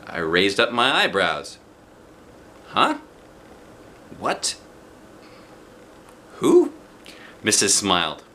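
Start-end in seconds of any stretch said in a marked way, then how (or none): 2.7-6.7 high startled questions; surprised. Acted. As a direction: react in disbelief. Surprised by secret admirer.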